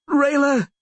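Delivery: high, desperate scream. A narrator's reading. Wounded Vilis calls to Rayla, screaming. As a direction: cry out her name in agony. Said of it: "he screamed indistinctly".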